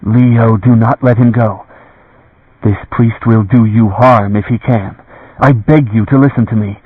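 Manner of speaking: ordinary delivery, nothing marked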